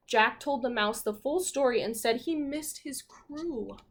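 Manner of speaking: plain reading voice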